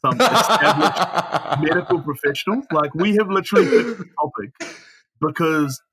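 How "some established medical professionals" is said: slow, laughing self-mockery. Real, not acted. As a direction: laugh through the words. Mocking their own status, laughing.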